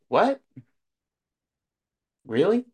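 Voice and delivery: high, rising disbelief; mock astonishment, pitch jumping up; incredulous. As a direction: question it with a sharp upward leap. His disbelief at friend's advice, retold.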